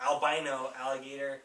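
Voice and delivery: usual speaking voice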